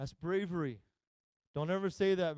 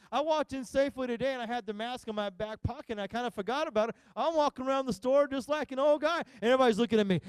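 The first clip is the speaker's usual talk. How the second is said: ordinary delivery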